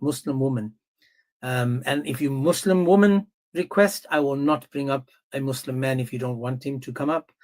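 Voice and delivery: usual speaking voice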